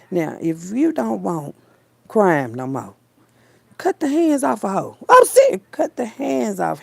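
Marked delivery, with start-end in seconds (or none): 3.8-6.8 emphatic rant. Real, not acted. Ranting to viewers about thieves, loud and emphatic. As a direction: hammer it home like a law.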